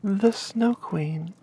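plain reading voice

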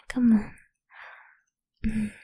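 usual speaking voice

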